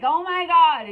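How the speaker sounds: high, loud mock alarm; hammed-up overwhelmed oh my God; mock panic. Mocking her old reaction to conflict, hammed-up panic. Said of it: "rather than seeing it as, like".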